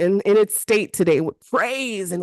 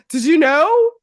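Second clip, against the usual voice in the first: sudden mock interruption; 6 semitones higher, 5 dB louder than usual. Acting out sudden interruption while washing dishes.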